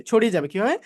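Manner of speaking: ordinary talk, nothing marked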